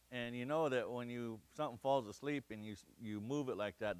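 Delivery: ordinary delivery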